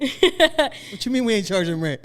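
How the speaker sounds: high, slow mock confrontation; mock gang demand. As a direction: challenge him like an offended gangster. Acts out the gang confronting the man.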